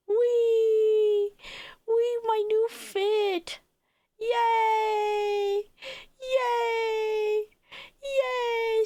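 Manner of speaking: high, drawn-out squeals of delight; excited high "whee" and "yay"; gleeful cheering. Real, not acted. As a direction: cheer high and hold each vowel long. Cheering at showing off new outfit.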